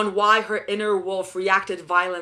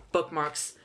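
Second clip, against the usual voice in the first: normal talking voice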